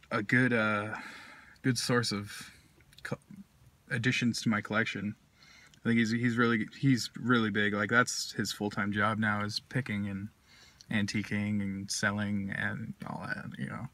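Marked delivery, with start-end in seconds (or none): 5.8-13.9 talking around pipe stem; pipe in mouth. Real, not acted. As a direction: lips clenched on stem. Seen 2: Pipe stem held between lips while talking, briefly lowered near 1.9.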